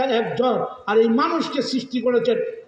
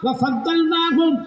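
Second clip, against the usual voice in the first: slow, melodic sung recitation with long held vowels; Quranic recitation; 3 semitones higher, slower than usual. Acted. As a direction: chant the verse slowly, stretching every vowel. Preacher recites Quran verse melodically.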